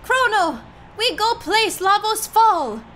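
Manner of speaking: calling out. Acted. Voicing cavewoman calling Chrono.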